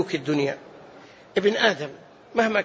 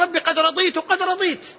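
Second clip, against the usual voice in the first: higher-pitched mock eagerness of the servant; retold eager acceptance; 7 semitones higher than usual. Servant telling his Lord he is content, retold eagerness.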